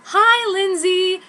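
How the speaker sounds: high, loud and slow sing-song greeting; mean girl Ichigo. Acted. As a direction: drag out the greeting sweetly. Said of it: "said Ichigo".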